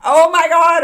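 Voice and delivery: loud, high oh my God; excited shout. Real, not acted. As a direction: blurt it out thrilled. Excited outburst.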